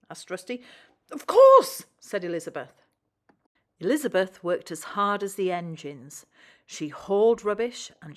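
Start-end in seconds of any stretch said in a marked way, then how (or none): 1.1-1.9 squeaky high and very loud; eager agreement. A narrator's reading. Lorry gladly agreeing to help, high loud burst. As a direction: burst out with delight.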